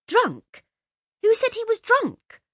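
high, loud outraged retort; furious. Acted. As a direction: fling it back at her sister in a fury. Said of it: "Laura turned furiously on Josie".

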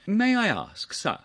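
high, lightly casual question; easy, conversational. A narrator's reading. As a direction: ask with breezy ease. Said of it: "in an easy conversational fashion".

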